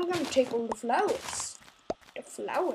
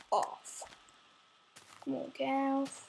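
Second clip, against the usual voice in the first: ordinary talk, nothing marked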